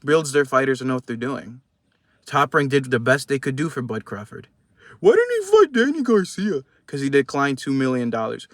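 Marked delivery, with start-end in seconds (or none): 5.0-6.6 high, whiny voice of a fan making excuses; sarcastic high fan impression; mocking doubter voice. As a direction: mimic the rival fans' excuses in a higher, louder voice. Mocking Spence fans' excuses.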